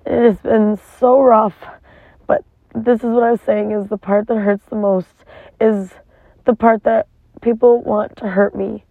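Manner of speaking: normal talking voice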